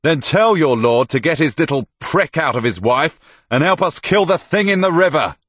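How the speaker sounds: loud, high, heated shouting; angry retort. A narrator's reading. Tomas to herald about his lord, shouting angrily. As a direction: shout your challenge back up the wall.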